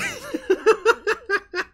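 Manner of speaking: shrieking high laugh, loud; high burst of laughter. Real, not acted. Laughing at herself mid-sentence, a high loud squeal.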